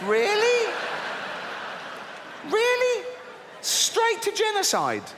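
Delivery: high-pitched disbelief, voice jumping way up; incredulous outrage. Real, not acted. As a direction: squeak out the disbelief. Outraged reaction to God's plan.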